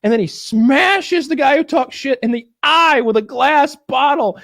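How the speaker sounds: ordinary talk, nothing marked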